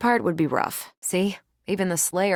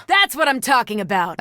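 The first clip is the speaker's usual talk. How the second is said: higher, pumped-up cheer; fired-up battle cheer; 6 semitones higher than usual. Acted. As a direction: celebrate a big hit.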